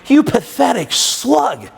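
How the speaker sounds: slow, drawn-out mock disgust; upset self-scolding. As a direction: berate yourself, stretching out the insult. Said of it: "I was really upset".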